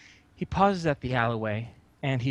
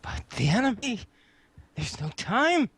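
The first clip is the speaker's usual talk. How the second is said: high, urgent plea; pitched-up anxious objection; frantic protest; 8 semitones higher than usual. Acted. Frantic devotee protests the delay.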